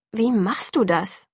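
slow, drawn-out question in irritated amazement; astonished and annoyed. A narrator's reading. Narrator asks Edward how he does it, astonished and annoyed. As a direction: ask slowly, both amazed and annoyed. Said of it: "erstaunt und verärgert zugleich".

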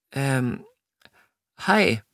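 hesitant, high-pitched greeting; falter, pause, then a high little word; stammering. A narrator's reading. Flustered Jessica greeting Edward, stammering. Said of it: "stammelte Jessica".

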